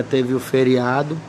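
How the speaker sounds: usual speaking voice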